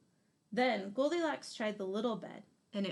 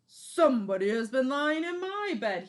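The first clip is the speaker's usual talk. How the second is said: much louder big bear outcry; Papa Bear crying out; 4 semitones higher, 9 dB louder than usual. Acted. Papa Bear finds someone lay on his bed, loud outcry. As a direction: boom it out, not amused. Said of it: "he cried".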